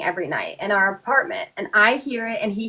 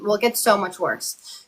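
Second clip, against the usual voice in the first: normal talking voice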